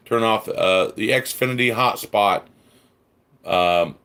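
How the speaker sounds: ordinary talk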